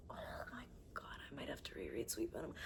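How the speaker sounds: fast, hushed whisper; quick secret whisper. Real, not acted. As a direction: whisper it in disbelief.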